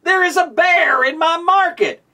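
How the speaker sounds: high, alarmed call about the bear; panicked shout. Acted. Market man panics at bear.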